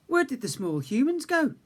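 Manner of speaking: put-on curious old uncle asking.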